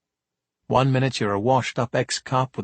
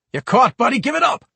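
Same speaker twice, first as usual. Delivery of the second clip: much higher, louder order; commanding shout; 12 semitones higher, 5 dB louder than usual. Acted. Cop kneels on kid, shouting commands. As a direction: shout him into giving up.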